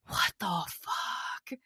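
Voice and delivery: slow, unvoiced stunned whisper; whispered, drawn-out what the fuck; whispered disbelief. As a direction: breathe it out in shock. Hears the conspiracy.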